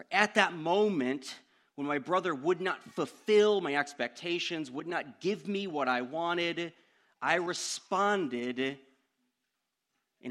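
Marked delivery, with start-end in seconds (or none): none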